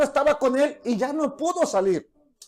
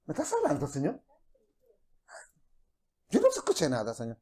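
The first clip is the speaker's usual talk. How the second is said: feigned innocence; 9 semitones lower, 9 dB quieter, faster than usual. Acted. Believer feigning not hearing God.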